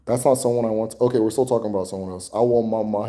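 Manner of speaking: normal talking voice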